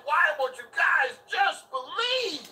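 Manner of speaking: imitating him with a drawn-out shout; mocking quote. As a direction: throw his line back, slow and yelling. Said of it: "He said".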